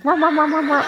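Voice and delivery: high, repeated mock barks; imitated dog barking. Porch dog warning off a stranger, imitated barking. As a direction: bark like a guard dog on a porch. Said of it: "a dog on their porch who's like".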